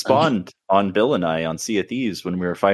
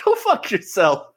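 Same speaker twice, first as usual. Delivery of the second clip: high laughing, crying-like retort; mock outrage; 11 semitones higher than usual. Player refusing Bill's worse weapon, mock outrage. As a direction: fire back a mock-angry insult. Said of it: "And I'm like".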